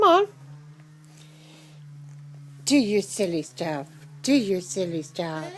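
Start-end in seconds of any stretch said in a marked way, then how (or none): 2.6-5.4 lower, softer coaxing. Real, not acted. Owner urging her bird to show off, low and soft.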